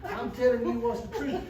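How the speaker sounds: usual speaking voice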